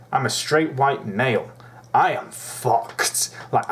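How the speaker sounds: normal talking voice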